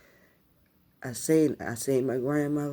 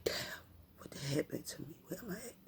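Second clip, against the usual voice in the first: hushed, breath-light shock; stunned bewilderment; 3 semitones higher, 13 dB quieter, faster than usual. Retold or imitated. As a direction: retell it very softly, lost and confused. Retold waking up in shock.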